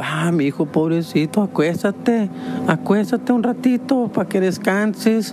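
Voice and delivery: high, tender motherly coo; doting mom. Retold or imitated. Mom tells her son to lie down and rest, high and tender. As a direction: fuss over your sick son.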